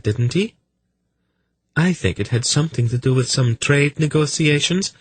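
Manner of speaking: high, innocently inquisitive lady's voice; sly young noblewoman, probing. Acted. Velvet leading Silk through his memories, sly and probing. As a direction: lead him on with high, polite questions.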